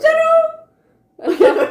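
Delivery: very high, loud call to the dog. Real, not acted. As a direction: call the dog across the room. Calling the dog again.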